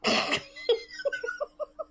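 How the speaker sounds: squealing high laugh. Real, not acted. Wife laughing at his ship logic.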